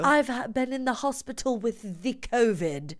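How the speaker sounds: hammed-up posh English; cut-glass English accent. Host as the hospital patient protesting, mock cut-glass English accent. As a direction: imitate an affronted royal-family English voice. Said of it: "it's called a cut glass English accent".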